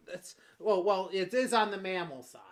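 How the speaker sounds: usual speaking voice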